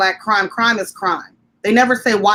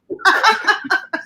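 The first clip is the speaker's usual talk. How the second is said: bright burst of laughter; high laugh; 12 semitones higher than usual. Real, not acted. Laughing at exchange.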